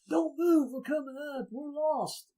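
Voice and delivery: high, urgent call from far below; a lost crew calling out for help; calling up the hill. Retold or imitated. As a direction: shout so they hear you up top. Lost men shouting up hill.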